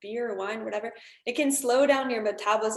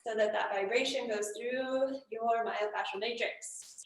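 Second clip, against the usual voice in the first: shaking voice; 7 dB quieter, slower than usual. Shown as a demonstration. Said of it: "shake your body like this".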